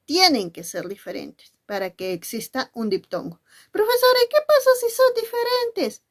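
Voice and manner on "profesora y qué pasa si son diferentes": high, curious pupil's voice asking the teacher. Acted. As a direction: play the student raising a question. (voz aguda de alumna que pregunta)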